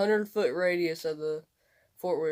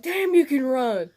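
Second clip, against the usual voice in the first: higher and louder, stunned and impressed; amazed praise; 7 semitones higher, 7 dB louder than usual. Retold or imitated. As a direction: mimic a buddy blown away by the speed. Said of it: "he's like".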